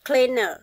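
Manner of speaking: cleaner repeated with a short uh at the end; schwa ending. Shown as a demonstration. Teacher repeats for pupils: cleaner, final uh. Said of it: "Các bạn để ý những âm này".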